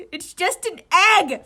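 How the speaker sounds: loud, slow high shout. Acted. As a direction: yell it slowly. Iwazumi shouting at panicking friend.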